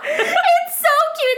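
very high, slow laughing insistence; gushing squeal. Real, not acted. Defending her sweater to co-host, high slow squeal.

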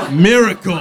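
shouted Miracle! loud and high; shouting capitalized words. Shown as a demonstration. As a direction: bellow Miracle! as if written in caps. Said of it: "it's in caps, so I have to".

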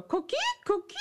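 high chirpy frog call; coqui frog call. Retold or imitated. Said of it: "your mom would go".

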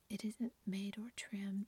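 ordinary delivery, nothing marked

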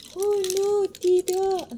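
high, loud "oh no"; alarmed. Pretend. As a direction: play up the shock. Alarm after the gasp.

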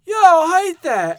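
hammed-up Brit grumbling; mocking British listeners, mock British accent; mock Brit complaining. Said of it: "all the British are like".

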